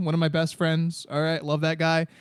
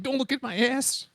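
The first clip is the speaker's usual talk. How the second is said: high, soft mock protest; mock Benji line; 7 semitones higher, 4 dB quieter than usual. Quoting Benji's line while walking around the house, mock prissy. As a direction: toss it off like a prissy catchphrase. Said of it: "I love the way you say that".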